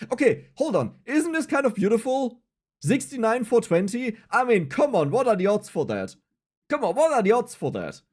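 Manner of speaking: high-pitched, thrilled disbelief; amazed excitement. Real, not acted. Streamer showing viewers lucky numbers, excited and amazed. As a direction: marvel at a wild coincidence.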